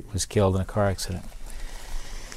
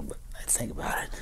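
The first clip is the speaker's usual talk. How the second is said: hushed, choked-up whisper; fighting back tears, whispered fast; emotional; 7 semitones lower, 11 dB quieter, faster than usual. Real, not acted. Veteran overcome recalling his father, whispered. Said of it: "I don't know why I'm getting emotional".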